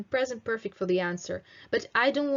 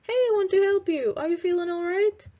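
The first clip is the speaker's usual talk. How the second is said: higher, louder, quick concerned question; caring check-in; 10 semitones higher, 4 dB louder, faster than usual. Shown as a demonstration. Teacher showing how to ask a friend, caring and high. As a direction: sound like you want to help. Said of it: "Yeah, it's like".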